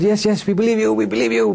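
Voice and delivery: high, eager crowd voice. Retold or imitated. Crowd answering the daredevil, high eager voices.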